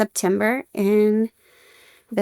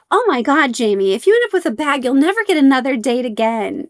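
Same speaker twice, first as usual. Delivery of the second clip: imitating a big sister's higher-pitched teasing; mock-dramatic exaggeration, pitched up; silly hyperbole; 5 semitones higher, faster than usual. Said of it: "speaking in hyperbole and, and being silly".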